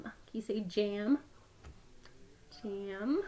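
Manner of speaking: normal talking voice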